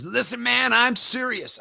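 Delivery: mock the mentor's angry scolding; mad warning. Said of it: "He got so mad".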